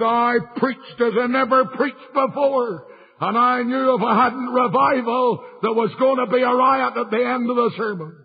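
high, ringing pulpit delivery; impassioned preaching. Retold or imitated. Evangelist declaring against the unsaved minister, high preaching retold. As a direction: declaim it high to the whole congregation.

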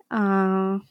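normal talking voice

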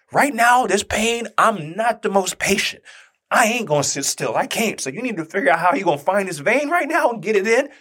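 exasperated retort. Retold or imitated. Squirming patient to nurse placing an IV, exasperated insistence.

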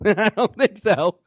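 slow, amused no with a laugh; laughing refusal. Acted. Believer turning down the tempter, laughing slowly. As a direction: laugh it off and decline.

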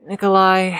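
a sudden call for Nikolai. Acted. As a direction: call his name to catch his attention. Calling out to get attention.

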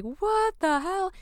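high, drawn-out astonished cry; amazed disbelief. Retold or imitated. Whole being reacting to partner's news, drawn-out high disbelief.

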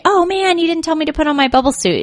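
high, fast mock complaint; playful teasing. Joking to a negative relative, high and fast. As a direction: make light of it, chirpy and quick. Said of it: "you just say".

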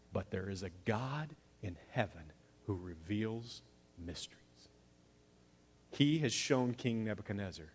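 soft, awed, almost whispered; humble Daniel. Acted. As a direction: answer the king with quiet reverence. Daniel answers the king humbly.

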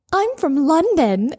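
high, drawn-out fake London accent; attempted British accent. Friend claiming to Londoners she's local, terrible British accent. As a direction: put on a bad, high-pitched British accent. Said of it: "trying to do a British accent".

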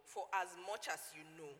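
solemn King James reading; scripture reading. Acted. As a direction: read the verse with solemn weight. Reading scripture aloud KJV.

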